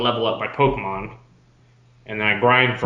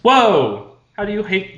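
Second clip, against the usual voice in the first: surprised; 9 semitones higher, 7 dB louder, slower than usual. Real, not acted. Reacts to hating Gyarados.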